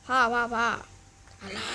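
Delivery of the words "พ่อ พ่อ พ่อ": louder calling for dad. Acted. Son calling his dad from the bathroom, loud call. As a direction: call him from the next room.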